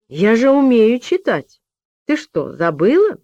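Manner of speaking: put-on high, indignant child reminder; proud girl reminding her friend; reminding little girl. A narrator's reading. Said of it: "напомнила Анечка".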